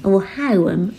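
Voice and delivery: home stretched to two syllables, haʊəm; drawn-out home like haʊəm; West Suffolk home. Shown as a demonstration. Showing her West Suffolk way of saying home. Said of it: "as I would say".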